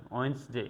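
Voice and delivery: ওয়েডনেসডে as "ওয়েনসডে", no d; ওয়েডনেসডে clipped to "ওয়েনসডে"; silent d Wednesday. Shown as a demonstration. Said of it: "এই জিনিসটাকে উজ্জ্ব রাখছি". (ডি বাদ দিয়ে ওয়েডনেসডে)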